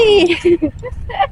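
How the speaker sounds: light laugh trailing off; trailing giggle. Real, not acted. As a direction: giggle at your own joke. Laughs at own negativity joke.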